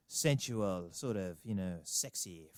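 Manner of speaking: hushed, slow mock-sensual delivery; mock sexy. Host teasing listeners about the guest's accent, mock sexy. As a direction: drop soft and low, teasingly sultry. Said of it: "It was doing it for me".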